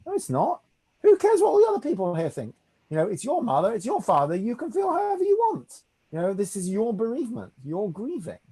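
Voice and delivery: high-pitched, insistent reassurance; emphatic reassurance. Retold or imitated. Rabbi to an embarrassed mourner at a funeral, emphatically reassuring. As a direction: firmly insist they needn't apologise, pitched well up.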